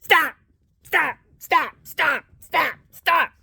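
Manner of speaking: high repeated commands; landlord's wife yelling stop; shrill stop. Retold or imitated. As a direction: bark each stop like a frantic neighbor. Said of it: "and she's like".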